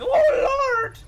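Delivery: high, loud, sudden cry; alarmed cry. Real, not acted. Gamer caught by surprise, alarmed cry. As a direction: burst out in alarm.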